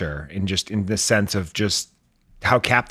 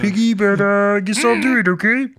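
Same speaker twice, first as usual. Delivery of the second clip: high nasal-free frog puppet voice, raised pitch; squeaky high Kermit impression; 11 semitones higher, 5 dB louder than usual. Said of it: "I don't even know if this sounds like Kermit".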